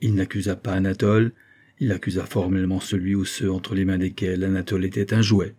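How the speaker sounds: ordinary delivery, nothing marked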